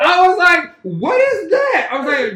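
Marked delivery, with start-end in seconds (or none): 0.8-1.9 high, slow, puzzled question; mock bewildered. Reacting to the King rubbing hands, slow baffled. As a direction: stretch it out, baffled.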